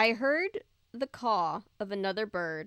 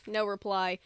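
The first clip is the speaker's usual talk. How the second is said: ordinary delivery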